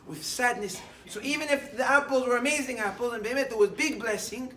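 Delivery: loud, high, pressing sermon voice; fervent preaching. Acted. Rabbi driving home the moral to listeners, loud fervent preaching. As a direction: preach it out with rising fervor.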